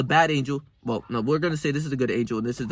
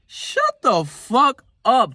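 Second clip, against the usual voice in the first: much higher, very slow, dragged-out snap; madman snapping at himself; 9 semitones higher, 4 dB louder, much slower than usual. Retold or imitated. Talking to himself like a madman, slow high snap. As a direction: bite each word off like a madman. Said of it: "talking to myself as if I'm a madman".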